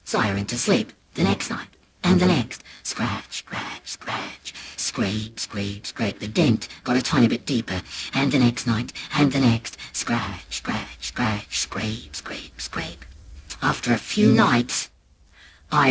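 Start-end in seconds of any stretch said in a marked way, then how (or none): 2.8-6.2 breathy whispered scratch sounds. A narrator's reading. Scratching sound effect words. 9.9-13.0 breathy whispered scratch sounds. A narrator's reading. Scratching sound effect words.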